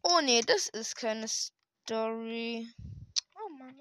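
usual speaking voice

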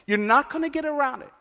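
high, urgent preaching that keeps climbing; preaching at full force. Acted. Preacher telling the congregation suffering comes, full-force preaching. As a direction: drive it home to the congregation.

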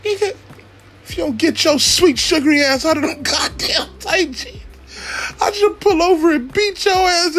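high-pitched scolding of the son; mock threat to son. Scolding his son in the car.

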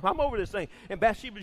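usual speaking voice

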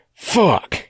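high, loud cry of pain; cried out. A narrator's reading. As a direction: yell it as if just shot. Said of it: "he cried".

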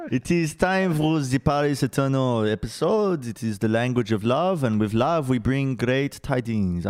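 slow, hammed-up French accent on English words; bad French accent. Host opens the Paris Eternal episode in a bad French accent. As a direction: ham up a fake French announcer. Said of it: "speak English in a bad French accent".

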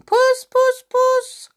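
very high, loud puss-puss call; calling a cat. A narrator's reading.

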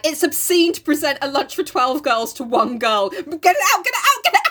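high, escalating into frantic squeals; mock panic. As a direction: build to a laughing, flustered shriek. Acted panic over the lunch.

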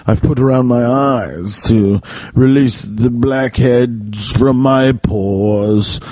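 put-on very slow, drawn-out old man. Raccoon explaining his mask, slow old man voice. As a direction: stretch the words out like a doddering old man. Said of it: "talking like an old man".

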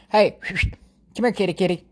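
high, soft kitty call; calling a cat. Real, not acted. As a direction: coax the cat over sweetly.

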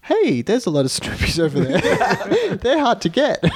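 high, slow, amused imitation; mock British girl. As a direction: play the girl's remark high, laughing through it. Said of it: "the British girl does comment".

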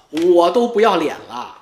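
ordinary delivery

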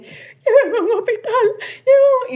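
retold desperate plea. Host retells begging friends for a hospital, high desperate plea. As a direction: beg to be taken to hospital, rising.

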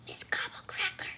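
whispered spooky name; ominous whisper. A narrator's reading. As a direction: reveal the name in a stagey whisper. Ominous name revealed in story.

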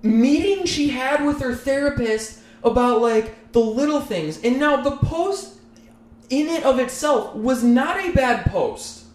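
high, giggly telling; fighting laughter through the story; laughing while telling. Real, not acted. Laughing while telling dishwasher post.

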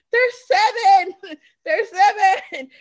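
slow, loud, high, crying with laughter; laughing disbelief. Retold or imitated. Thinking about the seven-year-olds, slow laughing wail. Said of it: "I'm like".

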